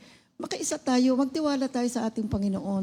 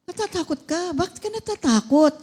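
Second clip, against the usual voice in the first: raised, louder surprised asking; surprised pastor questioning a frightened man; 8 semitones higher, 6 dB louder than usual. Retold or imitated. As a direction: ask it in disbelief. Preacher questions the patient.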